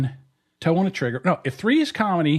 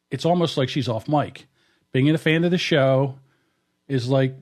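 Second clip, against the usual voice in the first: measured reading, higher than chat; letter read aloud; 4 semitones higher than usual. Real, not acted. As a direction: read it out phrase by phrase, with pauses. Reads own letter aloud.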